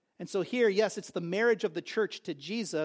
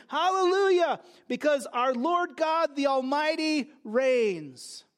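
proclaimed hallelujah; 6 semitones higher, 4 dB louder, slower than usual. Acted. Preacher proclaims heavenly crowd's praise.

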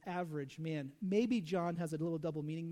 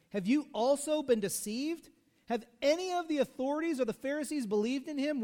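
raised, louder scornful challenge; indignant Pharisees; 6 semitones higher, 5 dB louder than usual. Retold or imitated. Preacher voicing Pharisees scolding their officers, indignant and loud. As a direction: voice the Pharisees' outrage, higher and louder.

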